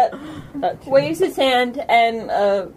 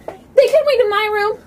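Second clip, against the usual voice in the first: quick, high, louder interjection; speaking up; 9 semitones higher, 5 dB louder, faster than usual. Acted. Kita offers her room to the visitors, speaking up. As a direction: pipe up eagerly, pitched high and quick. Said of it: "Kita speaks up".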